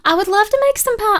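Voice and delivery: high-pitched, eager gush; delighted enthusiasm. Real, not acted. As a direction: gush with real longing for it. Delighted at idea of pie.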